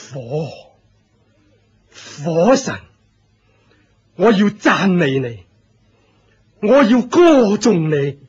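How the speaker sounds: exalt the fire god in rapture; ecstatic praise. Acted. Cult leader worshipping the fire god aloud, ecstatic.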